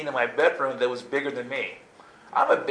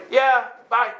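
high, loud hurried reply; calling back; 12 semitones higher, 7 dB louder than usual. Retold or imitated. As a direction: holler a quick brush-off upstairs. Hurried answer called to parents.

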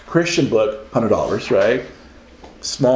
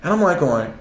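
ordinary talk, nothing marked

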